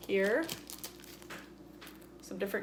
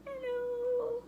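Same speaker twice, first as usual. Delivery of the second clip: very high squeaky little figure voice; tiny figure voice. Pretend. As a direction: squeak hello as a toy figure. Plasticine figure greets viewers.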